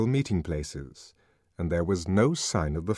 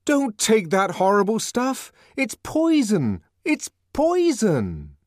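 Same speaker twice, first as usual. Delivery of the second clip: high-pitched, raised-voice warning; earnest, high, emphatic appeal; impassioned plea; 13 semitones higher, 4 dB louder, slower than usual. A narrator's reading. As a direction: warn them urgently, stretching the key word. Savage pleads with crowd to refuse soma.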